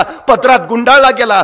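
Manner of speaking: ordinary delivery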